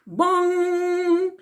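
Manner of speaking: voiced bong like a big clock bell. A narrator's reading. Narrator voicing Big Ben striking, bell bong.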